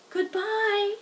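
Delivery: put-on a baby spider calls farewell as it floats off; tiny spider's goodbye. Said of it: "it said as it sailed".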